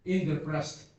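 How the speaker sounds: usual speaking voice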